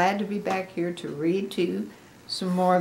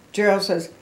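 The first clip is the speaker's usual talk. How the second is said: usual speaking voice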